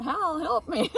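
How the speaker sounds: high, loud cry for help; mock-panicked shout; retold yelling. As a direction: yell for help, laughing as you retell it. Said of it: "and yelling at Hal".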